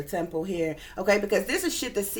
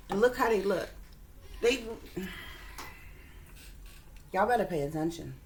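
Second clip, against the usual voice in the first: ordinary talk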